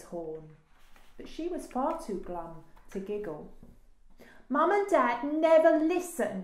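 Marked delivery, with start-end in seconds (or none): 4.5-6.4 high, loud sighing complaint; exasperated sigh. A narrator's reading. Daisy confides in the rhino, sighing complaint. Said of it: "she sighed".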